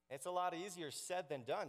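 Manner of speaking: usual speaking voice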